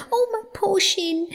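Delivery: high-pitched whimpering mole; pained mole cry. A narrator's reading. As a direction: wail over an injury in a high little voice. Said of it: "he cried".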